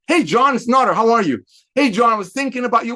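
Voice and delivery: lively opening to a phone call; upbeat phone greeting. Shown as a demonstration. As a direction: greet a friend with eager energy. Demonstrates phoning a friend.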